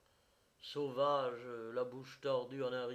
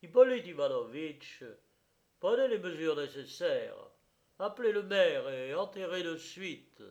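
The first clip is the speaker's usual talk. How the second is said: loud, commanding delivery; imperative order; 3 semitones higher, 7 dB louder than usual. A narrator's reading. The justice of the peace orders the commissioner to act, commanding. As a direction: issue firm orders with raised voice. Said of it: "ordonna impérativement au commissaire".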